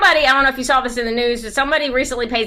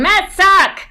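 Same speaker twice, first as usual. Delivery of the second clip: loud stadium yell, high and forceful; fan yell; 10 semitones higher, 6 dB louder than usual. Retold or imitated. Yankees fans yelling at the Mets, a loud high taunt. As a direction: bellow it across the stands. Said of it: "always yelling".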